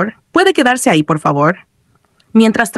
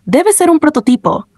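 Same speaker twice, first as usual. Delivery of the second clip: higher-pitched remark of surprise; surprised; 8 semitones higher than usual. A narrator's reading. Player advances in the game, surprised. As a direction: raise the pitch as if struck by a discovery. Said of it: "comentó sorprendido".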